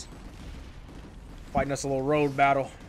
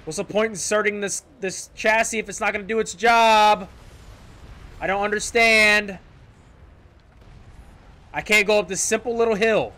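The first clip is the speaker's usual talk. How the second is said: raised, higher voice in exasperation; frustrated complaint; 5 semitones higher, 5 dB louder than usual. Real, not acted. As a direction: complain loudly at useless gear. Complaining about useless chassis.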